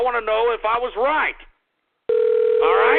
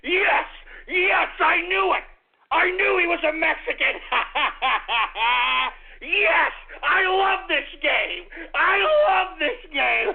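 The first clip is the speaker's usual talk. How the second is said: triumphant shouting; 6 semitones higher, slower than usual. Real, not acted. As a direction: celebrate a correct guess, half laughing. Host celebrates correct guess.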